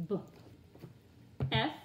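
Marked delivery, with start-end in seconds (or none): 0.1-0.2 B as a short "buh"; letter sound. Shown as a demonstration. Teacher drilling letter sounds, B as "buh".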